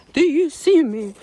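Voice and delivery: loud, high, slow; cheeky attention call; playful call. Pretend. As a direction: sing it out to be noticed. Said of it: "I don't know why I say that".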